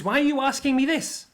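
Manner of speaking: high-pitched, defensive questioning; defensive father. Retold or imitated. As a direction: retort as a father put on the defensive. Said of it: "So he gets defensive".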